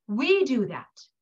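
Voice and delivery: ordinary delivery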